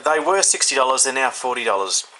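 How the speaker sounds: usual speaking voice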